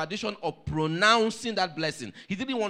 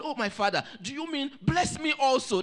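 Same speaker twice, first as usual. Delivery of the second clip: upset plea; 7 semitones higher than usual. Acted. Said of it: "He was so angry".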